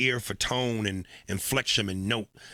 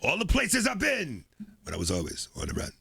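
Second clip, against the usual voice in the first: [name] prayer voice; 5 semitones higher than usual. Acted. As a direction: pray hard like [name]. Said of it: "his prayers".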